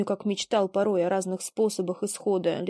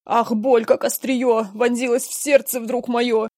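pained cry; 4 semitones higher, 6 dB louder than usual. Acted. Father cries out, heart attack.

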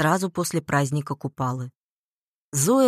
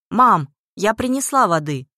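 raised, higher, louder call; 5 semitones higher, 6 dB louder than usual. A narrator's reading. Daughter tells her mother she has brought water, calling out. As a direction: call out so mum hears it. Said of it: "крикнула матери".

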